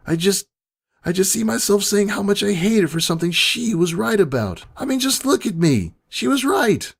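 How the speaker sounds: high, strained, breaking grief; near tears. A narrator's reading. Pony grieving her dead friend to her double, voice breaking. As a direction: let the voice climb and crack with grief. Breaking down over Twilight's death.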